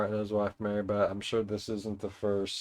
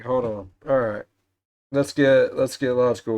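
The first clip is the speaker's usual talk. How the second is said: loud; 10 dB louder than usual. Real, not acted. Psyching up over quiz answer.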